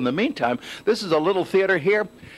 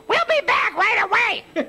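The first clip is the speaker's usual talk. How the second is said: slow, very high puppet squeal. Shown as a demonstration. Reporter demonstrating a good puppet voice, high and drawn out. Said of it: "Give him a good puppet voice".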